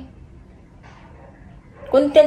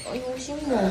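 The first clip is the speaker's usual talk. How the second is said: much softer, clipped quiet prompt; hushed aside; 10 dB quieter than usual. Pretend. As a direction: drop to a murmur. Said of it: "Para ka lang nakikipag-usap sa bata".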